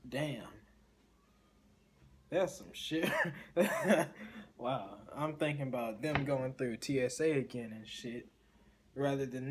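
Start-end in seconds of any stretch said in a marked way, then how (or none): 3.1-4.5 high, loud laugh. Real, not acted. Listener reacting to a creepy airport story, laughing. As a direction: burst out laughing.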